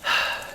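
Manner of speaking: put-on a long, heavy little-girl sigh before speaking; deep sigh. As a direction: breathe out deeply like a solemn child. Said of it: "take a deep breath".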